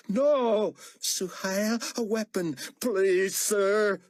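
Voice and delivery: high, loud pleading; terrified pleading; desperate begging. A narrator's reading. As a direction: beg for his life. Moon begs for his life.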